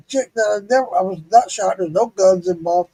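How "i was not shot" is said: usual speaking voice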